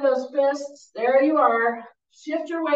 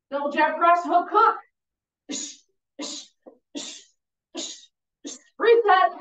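loud punchy combo calls; boxing coach calling combos; 5 semitones higher, 3 dB louder than usual. Real, not acted. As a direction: bark the punches out to the class.

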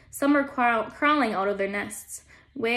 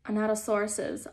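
ordinary delivery

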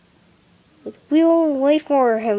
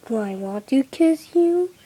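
normal talking voice

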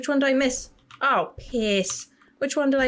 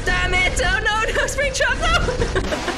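high, loud cry of panic; shrieking no; panicked yell; 13 semitones higher, 3 dB louder, slower than usual. Real, not acted. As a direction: scream as he catches you. Caught by the monster.

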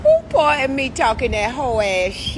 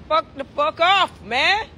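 ordinary talk

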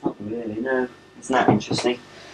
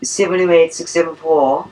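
higher put-on phone-answering voice; imitating mum answering the phone, pitched up; mum's telephone voice; 5 semitones higher than usual. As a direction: recite the number in an affected telephone voice. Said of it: "she puts on a voice".

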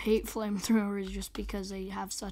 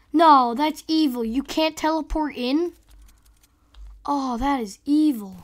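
indignant outcry; 3 semitones higher, 11 dB louder than usual. Real, not acted. Outraged at game mechanic.

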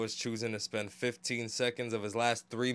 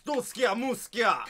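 high-pitched fake Russian gibberish; mock Russian accent; 8 semitones higher, slower than usual. Gamer quoting his baby mama in Russia, mock Russian accent. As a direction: imitate a Russian woman in nonsense words. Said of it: "She says".

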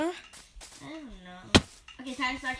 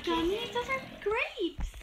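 put-on baby voice; 9 semitones higher than usual. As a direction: talk like a little toddler. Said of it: "my sister's gonna talk like a baby voice".

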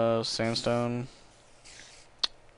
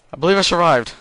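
loud relief; 8 semitones higher, 11 dB louder than usual. Real, not acted. Relief after attack.

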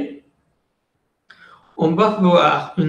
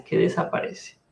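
fading whisper; 7 dB quieter than usual. Real, not acted. Teaching, fades to whisper.

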